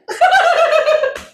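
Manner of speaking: loud, high cackle; laughing. Real, not acted. Co-host unsure of the heat rating, loud high laugh.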